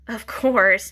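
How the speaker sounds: plain reading voice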